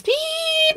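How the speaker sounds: a high, sharp electronic beep; imitated phone beep. Explaining Find My Device, mimicking the phone's beep. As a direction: mimic the phone alarm going off. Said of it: "you're gonna hear this noise".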